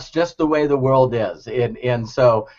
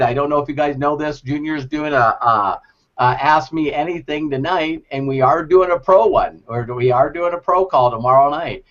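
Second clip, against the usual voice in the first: ordinary talk, nothing marked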